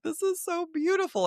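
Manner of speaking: high, swooning gush; gushing awe. Retold or imitated. Host recalling her first read in the library, high gush. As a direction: gush as if moved to tears. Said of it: "I was like".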